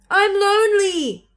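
high, slow, sad call; lonely call. A narrator's reading. Little beaver admitting he is lonely, high slow call. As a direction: let the loneliness carry across. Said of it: "he said".

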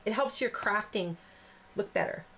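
ordinary talk

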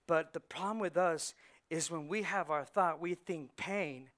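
ordinary talk, nothing marked

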